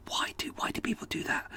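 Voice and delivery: voiceless, exasperated whisper. Real, not acted. Exasperated after demonstrating shouted greeting.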